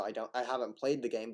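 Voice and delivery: ordinary delivery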